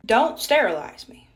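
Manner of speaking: slow, loud and insistent: don't sterilize me; firm loud refusal. Retold or imitated. Patient to nurse, refusing sterilization, slow loud insistence. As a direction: plant your feet and refuse, slowly.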